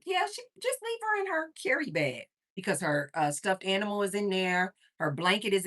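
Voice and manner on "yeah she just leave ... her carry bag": telling staff. Retold or imitated. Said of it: "I always kinda tell them".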